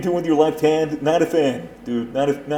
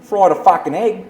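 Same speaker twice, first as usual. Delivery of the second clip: broad Aussie, cheerful and rough; hammed-up Aussie breakfast story; Aussie accent; 3 semitones higher than usual. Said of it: "That is very good".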